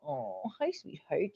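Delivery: talking softly to her dog. Real, not acted. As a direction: coo gently at the dog.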